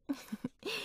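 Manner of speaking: a short, soft rising giggle; light teasing laugh under the breath. Acted. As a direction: giggle at him playfully. Teasing the boss.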